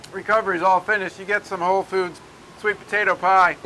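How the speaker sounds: usual speaking voice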